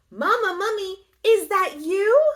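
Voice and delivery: a tiny mummy calling for mama; little baby mummy. Acted. Baby mummy calls into the woods, high and loud.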